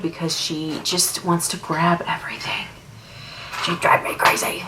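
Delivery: hushed, breathy whisper. Real, not acted. Mom whispering about her baby while doing makeup, whispered.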